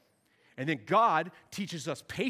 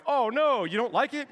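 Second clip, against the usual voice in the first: mock sympathy; 4 semitones higher, 5 dB louder than usual. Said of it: "I'm just like".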